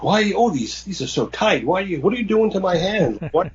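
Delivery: high, questioning retold captive; mock alarmed protest. Captive questioning the woman strapping him in, mock alarm. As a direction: protest as the straps tighten, lightly acted.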